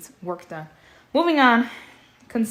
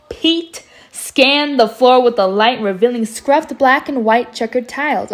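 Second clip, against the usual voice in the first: much louder reread; 3 semitones higher, 12 dB louder than usual. Shown as a demonstration. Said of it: "Okay, I know which one they're in now".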